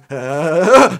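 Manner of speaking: loud vocal imitation of a car; car noise imitation. Game master showing how the car goes, imitated car noise. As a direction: mimic a car straining, loudly. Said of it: "and the car goes".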